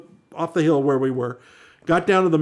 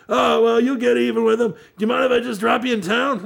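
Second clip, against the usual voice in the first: hippie driver; 7 semitones higher than usual. Retold or imitated. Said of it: "it's like".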